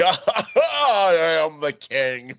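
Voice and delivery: high, loud, very slow grand pronouncement; Bowery King impression. Retelling the movie, imitating the Bowery King's slow royal boast. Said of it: "and he's just like".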